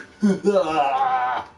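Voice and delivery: loud amused laugh at his own joke; big delighted laugh; burst of laughter. Real, not acted. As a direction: crack up loudly. Laughs at his own game title.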